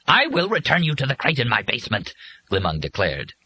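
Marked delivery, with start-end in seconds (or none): 0.1-2.1 higher-pitched, mildly furious decree; ruthless fury. A narrator's reading. Furious bird banishing a disobedient worker, mildly furious. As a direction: voice an understated, overwhelming agitation. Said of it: "cut him off with ruthless fury".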